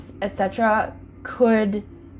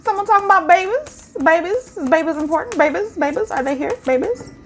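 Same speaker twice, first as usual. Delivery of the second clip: much higher, louder sing-song; playful high voice; 11 semitones higher, 6 dB louder than usual. Acted. Reader muses on babies in the cards, playful high voice.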